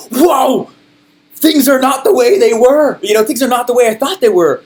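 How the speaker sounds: loud, high-pitched amazement; amazed epiphany. Acted. Newcomer having an epiphany in the challenge, loud amazement. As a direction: exclaim it as a sudden revelation.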